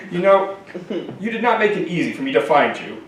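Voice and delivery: a leather-clad heavy who speaks high and prissy; high effeminate voice. Acted. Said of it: "the highest, most effeminate voice I'd ever heard".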